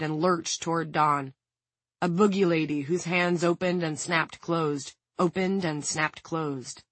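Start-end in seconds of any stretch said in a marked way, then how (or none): none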